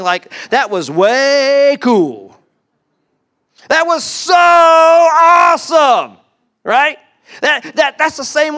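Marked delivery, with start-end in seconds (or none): 0.5-2.4 WAY stretched out long and loud; gushing, with a drawn-out, punched WAY; emphatic WAY. Shown as a demonstration. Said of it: "emphasize something by saying". 3.7-6.2 SO stretched out in a high shout; over-the-top gushing, SO held very long; emphatic SO. Shown as a demonstration. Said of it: "emphasize something by saying".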